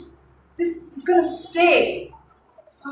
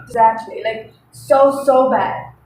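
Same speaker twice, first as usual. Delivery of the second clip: ordinary talk, nothing marked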